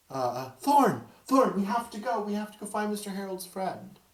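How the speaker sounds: put-on high child voice, hurried; a little girl pulling her friend along; urgent ghost child. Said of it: "And you hear her go".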